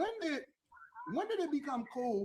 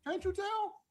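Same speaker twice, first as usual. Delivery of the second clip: high teasing voice; 8 semitones higher than usual. Pretend.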